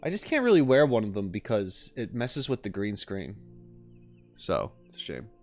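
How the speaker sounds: ordinary delivery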